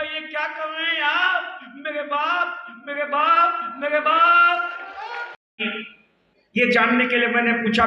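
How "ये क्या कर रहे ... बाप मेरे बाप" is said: दर्द भरी चिल्लाहट. Retold or imitated. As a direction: imitate a son crying out, each repeat stronger. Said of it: "बड़ी जोर से चिल्लाया". (पिटे बेटे की ऊँची, नकली चीख-पुकार)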